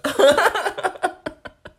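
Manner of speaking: high burst of laughter; sudden loud hoot of laughter. Real, not acted. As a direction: let out a big cackle. Laughing at answer.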